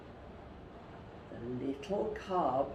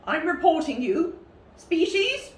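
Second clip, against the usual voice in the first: louder, higher, sharp question; angry demand; 10 semitones higher, 7 dB louder than usual. Acted. Presenter fumes at the cub and demands his species. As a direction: demand an answer, fuming.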